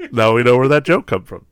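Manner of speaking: ordinary talk, nothing marked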